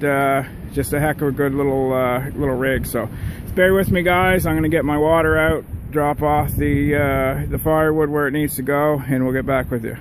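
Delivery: normal talking voice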